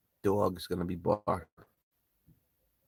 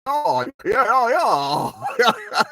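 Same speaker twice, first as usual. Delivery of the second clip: higher, louder approving yeahs; Bernie Mac's yeah; 12 semitones higher, 7 dB louder, much slower than usual. Retold or imitated. Showing viewers how Bernie Mac says yeah, laughing. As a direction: stretch the yeahs, impressed and amused. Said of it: "he's like".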